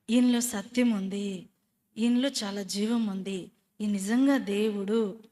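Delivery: slow proclamation. Acted. Declaring faith to congregation.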